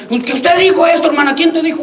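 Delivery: high-pitched, acted accusation; hammed-up church squabble; mock confrontation. Acted quarrel over gossip.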